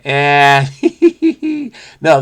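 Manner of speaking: normal talking voice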